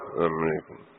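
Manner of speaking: low, barely audible salaam, swallowed; mumbled greeting. Retold or imitated. Passer-by mumbling a greeting nobody can hear, low and soft. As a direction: mutter "السلام عليكم" low and soft so nobody hears. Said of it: "يا ما يسمع يعني".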